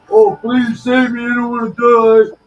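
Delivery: high, loud whiny plea, hammed up; mock begging. Paralyzed man mocking a plea he never made, high and loud. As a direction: sneer at the idea of pleading for his life. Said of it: "I didn't cry like a bitch".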